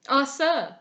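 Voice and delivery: anguished cry. A narrator's reading. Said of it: "cried Delvile".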